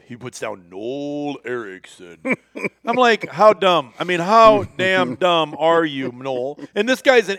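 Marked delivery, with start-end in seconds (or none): 0.7-2.2 low, soft and drawn out; mock-earnest slow name. Acts out writing his real name. 3.3-6.6 loud, incredulous telling-off; loud mock dressing-down; exasperated scolding. Retold scolding of a foolish friend.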